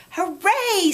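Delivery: high, bright cry of joy; excited cheer. Acted. Chrysanthemum cheering on her first school day, excited. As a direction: burst out happily. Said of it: "said Chrysanthemum".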